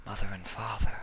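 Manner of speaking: quiet realisation; dawning worry. Acted. Octavia suddenly remembering her parents, soft and uneasy.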